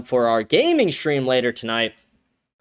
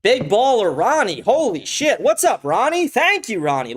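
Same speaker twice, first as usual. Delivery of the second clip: excited shout-out; 7 semitones higher than usual. Real, not acted. Streamer thanking donor Ronnie, high excited hype. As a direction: hype up the big gifter, pitch soaring.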